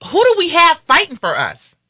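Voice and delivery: high-pitched, heated outburst of real anger. Real, not acted. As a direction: let the outrage spill over as the pitch jumps. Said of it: "'Cause now I'm angry".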